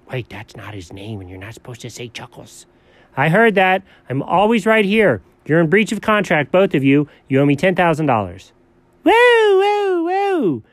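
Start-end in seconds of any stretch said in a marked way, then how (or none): 0.1-2.7 very soft, low warning; low secretive aside; hushed aside. Acted. Hushed aside about a forbidden name. 9.0-10.6 very high, drawn-out whoas; comic high alarm. Acted. Alarmed at ten thousand dollar demand.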